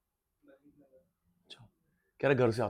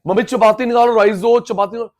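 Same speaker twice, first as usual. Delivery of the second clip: put-on louder, higher child calling to mum; eager child asking; 5 semitones higher, 9 dB louder than usual. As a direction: call out to mum like a hungry kid.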